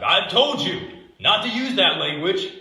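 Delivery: loud, high mock yell at a child; scolding parent. Parent yelling at kid for a bad word, loud mock scolding. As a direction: bark it like a fed-up parent at a kid.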